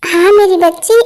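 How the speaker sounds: ordinary delivery, nothing marked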